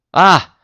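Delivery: loud, high "ah" cry; narrated cry. A narrator's reading. Adele cries out as if struck, loud and high. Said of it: "gridò".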